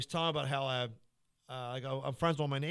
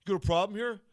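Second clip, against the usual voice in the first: put-on tough boyfriend; 6 semitones higher, 8 dB louder than usual. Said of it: "musclehead boyfriend comes over".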